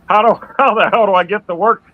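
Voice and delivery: mock disbelief at the missing ramp; a touch of comic panic; retold panic. Driver finds the on-ramp gone.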